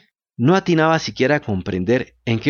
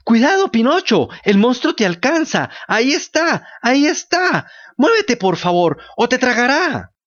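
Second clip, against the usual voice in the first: panicked warning; 9 semitones higher than usual. A narrator's reading. Goat shrieking at Pinocchio as the shark nears, frantic. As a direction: scream the warning as the shark closes in.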